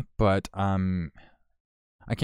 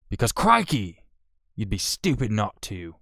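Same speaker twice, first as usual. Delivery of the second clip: bad Australian accent; 2 semitones higher, 4 dB louder than usual. Vlogger on Australian Survivor, badly faked Australian accent. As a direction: fake Australian accent slipping into pirate. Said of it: "I think I went pirate with that shit".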